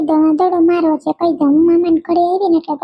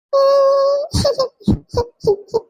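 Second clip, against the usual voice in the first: put-on a high, teasing child's laugh; a kid giggling at her cousin; child's giggle; 9 semitones higher than usual. Girl giggles at her cousin after keeping the ball.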